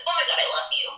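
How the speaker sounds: high, mock-smitten gush. Mimicking someone a month into a relationship, gushing. Mocking too-early love declaration.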